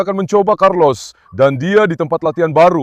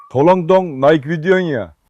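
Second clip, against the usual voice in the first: slow plea; 3 semitones lower, slower than usual. Real, not acted. Begging viewers for likes.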